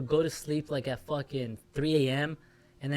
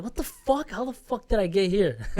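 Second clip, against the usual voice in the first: baffled; 7 semitones higher, 5 dB louder than usual. Retold or imitated. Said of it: "And I'm like".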